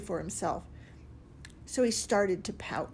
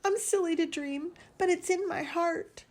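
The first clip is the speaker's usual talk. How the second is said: choked back tears; 9 semitones higher than usual. A narrator's reading. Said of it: "choked back his tears".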